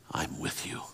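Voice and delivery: unvoiced, soft whisper of God's comfort. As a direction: breathe it like a whisper into the night. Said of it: "whisper over top".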